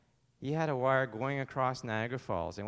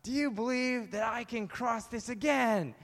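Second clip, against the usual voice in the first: high, slow showman's challenge; addressing a crowd; 9 semitones higher, 3 dB louder, slower than usual. Acted. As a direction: call out to a big audience. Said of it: "he says to the crowd".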